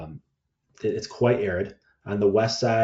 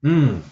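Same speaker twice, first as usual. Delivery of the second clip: considering hum before answering; a loud pondering hmm; thinking hmm; 7 semitones higher, 9 dB louder than usual. Real, not acted. Pondering the host's question.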